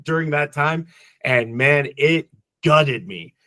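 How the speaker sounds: ordinary talk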